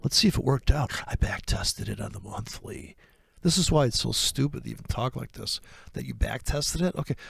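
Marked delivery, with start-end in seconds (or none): none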